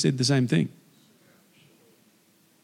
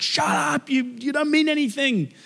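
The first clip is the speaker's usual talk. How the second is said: acted scolding crowd; 11 semitones higher than usual. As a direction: play the crowd putting a beggar down. Crowd scolds the screaming man.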